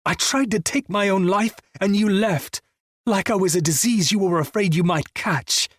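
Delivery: high, furious accusation; rage. Acted. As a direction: let the hurt explode. Raging at friend who left.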